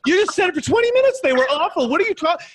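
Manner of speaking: can't believe what she just did; incredulous. Retold or imitated. Salesman stunned by the customer's turnaround, high incredulous. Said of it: "I was like".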